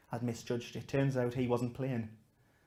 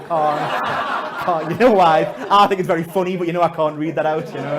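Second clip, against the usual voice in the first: laughing protest; 4 semitones higher, 14 dB louder than usual. Retold or imitated. Pleading with the girl.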